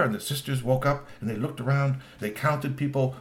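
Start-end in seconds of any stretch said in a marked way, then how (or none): none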